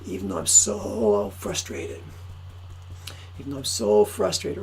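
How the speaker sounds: low, slow frustrated mutter; a lightly voiced tapping script; tapping demo. Shown as a demonstration. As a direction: sink the voice down as you tap. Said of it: "saying something negative, like".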